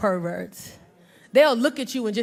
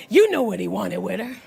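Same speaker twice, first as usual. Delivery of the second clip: knowing men; 5 semitones lower than usual. Retold or imitated. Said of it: "men always say".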